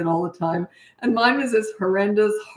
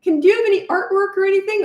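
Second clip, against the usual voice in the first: put-on high, eager little kid asking mommy; high childlike voice, a bit louder; little girl voice; 12 semitones higher, 4 dB louder than usual. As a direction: pitch way up like a small child begging. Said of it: "And I'd say".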